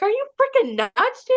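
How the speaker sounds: high-pitched retold panic; high, incredulous freak-out, retold. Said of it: "Like, I was freaking out".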